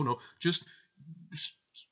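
ordinary talk, nothing marked

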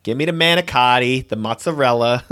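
manicotti and mozzarella with every vowel sounded, American style; deliberately unaccented "manicotti", "mozzarella"; American pronunciation. Shown as a demonstration. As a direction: say "manicotti" and "mozzarella" in full, no Italian. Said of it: "say it in our terrible American accents".

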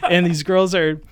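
ordinary delivery

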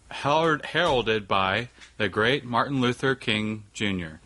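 ordinary talk, nothing marked

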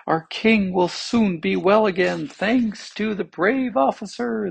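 high, celebratory crowd cry; joyful cry. A narrator's reading. Crowd cheers the returning officer, crying out joyfully. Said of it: "they cried".

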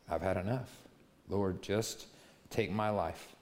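mild despair, barely pushing out the words. Retold or imitated. Elijah praying to die under a tree, soft weary despair. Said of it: "he prayed that he might die".

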